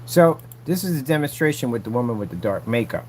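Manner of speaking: ordinary talk, nothing marked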